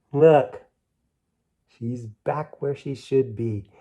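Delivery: ordinary delivery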